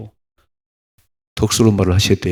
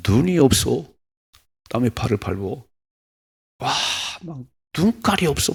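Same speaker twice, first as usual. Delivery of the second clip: higher-pitched, put-on grumbling; mock indignant complaint; 4 semitones higher than usual. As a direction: act out an irritated victim's complaint. Acted grumble when foot stepped on. (짜증 내며 투덜대는 흉내)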